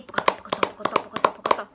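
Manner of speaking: clip-clop pocoto in a whisper; galloping hoof sound. Shown as a demonstration. Teacher to kids, whispering galloping hooves. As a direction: click out hooves.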